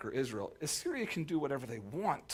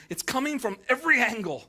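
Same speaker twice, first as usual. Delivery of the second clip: higher and louder, fed up; exasperated cry; 9 semitones higher, 7 dB louder than usual. Retold or imitated. Preacher voicing the psalmist, exasperated and louder. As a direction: throw up your hands at every angle.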